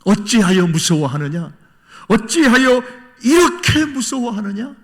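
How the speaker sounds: loud, high scolding question; acted rebuke. Jesus supposedly reproving frightened disciples in the boat, rebuking. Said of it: "책망하시는 말씀 아니에요".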